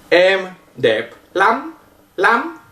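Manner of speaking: "Em đẹp lắm" slowly, "lắm" nasal and rising high; nasal short A. Shown as a demonstration. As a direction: model it slowly, lifting "lắm" through the nose. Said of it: "il est nasal et court". (« lắm » avec un A nasal, court, montant)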